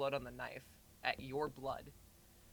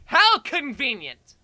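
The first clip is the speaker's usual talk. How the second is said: sarcastic; 9 semitones higher, 16 dB louder, much slower than usual. A narrator's reading. Sarcastic remark in the story.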